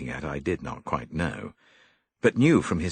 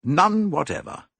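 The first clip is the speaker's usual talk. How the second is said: curt and stiff; 10 semitones higher, 6 dB louder than usual. A narrator's reading. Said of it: "He spoke stiffly".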